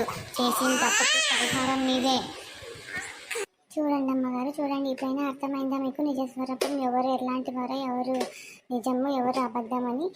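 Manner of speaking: ordinary delivery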